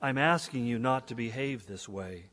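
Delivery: normal talking voice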